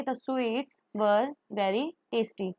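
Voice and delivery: very slow, clear teaching read; slow model sentence. Shown as a demonstration. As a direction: say it slowly for the class. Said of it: "तो हम कैसे लगाएंगे?". (धीरे-धीरे साफ़ पढ़ना)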